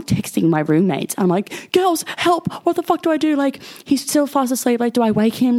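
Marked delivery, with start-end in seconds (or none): 1.7-5.6 high-pitched frantic plea; retold panic, pitched high; mock panic. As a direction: act out a panicked cry for help. Retold panicked texts to roommates.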